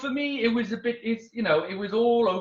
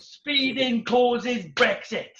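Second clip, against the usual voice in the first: slow punchline; 3 semitones higher, 3 dB louder, much slower than usual. Acted.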